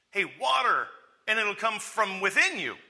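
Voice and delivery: high, loud, hyped-up announcement; animated acted proclamation; enthusiastic offer. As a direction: pitch it like exciting news. Acts out Jesus offering water.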